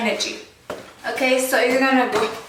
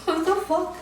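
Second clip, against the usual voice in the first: exasperated; 6 semitones higher, slower than usual. Real, not acted. Frustrated at forgetting dance.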